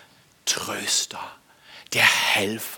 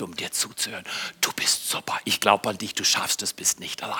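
soft, hushed encouragement; tender; 6 semitones lower, 6 dB quieter, faster than usual. Retold or imitated. Father gently telling his child he believes in him. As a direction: whisper it like a proud father.

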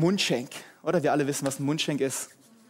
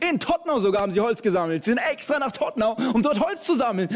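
normal talking voice

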